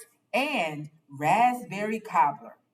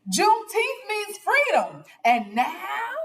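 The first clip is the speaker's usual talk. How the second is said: high, loud joyful cheer; 13 semitones higher, 4 dB louder than usual. Acted. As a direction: lift the words up like a celebration. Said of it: "lifted her hands and cheered".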